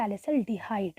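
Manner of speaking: whispered hint, slow and hushed. Shown as a demonstration. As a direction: drop to a secret whisper for the key word. Teacher whispering key answer. (फुसफुसाकर, धीरे)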